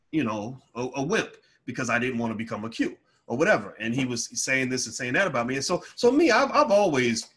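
ordinary talk, nothing marked

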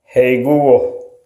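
ordinary delivery

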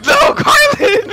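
high, loud, slow panicked shriek; panicked scream. Real, not acted. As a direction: scream in real fright. Panicked yell at spider.

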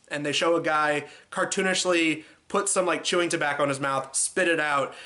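ordinary talk, nothing marked

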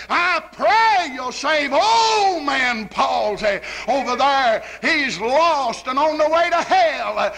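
slow, drawn-out pleading, falling away at the end; acted fervent plea to God, swelling then dropping; rafter-raising prayer. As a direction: pray like an impassioned preacher, stretching the key words. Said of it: "hears Brother Pryor up there raising the rafters".

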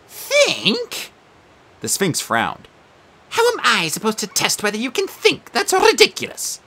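high prim quizmaster creature; Sphinx voice. Acted. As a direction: play a fussy exam monster.

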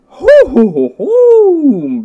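loud high hooting whoops; excited falsetto hoo-hoos; delighted whooping. Real, not acted. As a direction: whoop with glee, the last one held long. Delighted at what is found.

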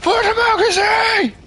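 soaring high battle cry shout. Acted. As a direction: bellow it like a charging soldier.

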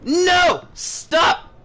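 high, loud frightened pleading; panicked. Real, not acted. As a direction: beg it to stop in terror. Sanity drop scares him.

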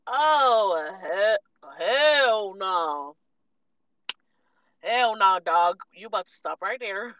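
loud, outraged call-out; outraged hell no. Retold or imitated. Calling out the fry cook, loud and outraged. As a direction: shut the worker down hard. Confronting fast food worker, outraged.

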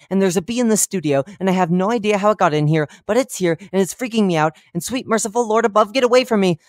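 high, loud, rushing panic; frantic host losing it on air; panicked about a bee. Acted.

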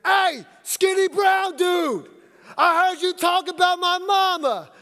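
yelled threat. Retold or imitated. Stranger calling out the coach by his looks, loud threat. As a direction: bellow it across the court, slow and menacing. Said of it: "And then I hear".

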